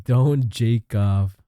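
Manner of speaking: very slow, drawn out; slow repeat. Shown as a demonstration. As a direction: spell out each word slowly. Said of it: "that's crazy".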